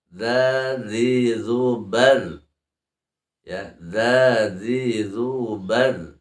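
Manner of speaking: slow sing-song dza zi zubar; drilled dzal sounds. Shown as a demonstration. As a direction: draw out each dzal syllable. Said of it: "tapi idza". (latihan dza zi zubar, pelan)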